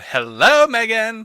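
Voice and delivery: high, loud mock greeting of Hello, Megan. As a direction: parody a cartoon character's grating hello. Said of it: "if Megan keeps going".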